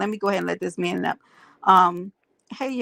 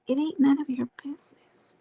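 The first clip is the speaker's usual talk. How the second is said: muttered aside; 6 semitones higher, 3 dB quieter than usual. Real, not acted.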